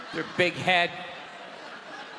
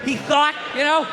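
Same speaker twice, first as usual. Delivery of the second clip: loud, high, heated rant; high, loud rant at a heckler; heated shout; 6 semitones higher, 3 dB louder than usual. Real, not acted. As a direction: yell it at the crowd. Comic yelling at a heckler.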